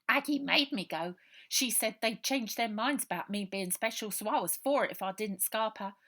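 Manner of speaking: put-on a scared street boy pouring it all out; orphan boy Robert. Runaway boy telling Ida his story, loud and urgent.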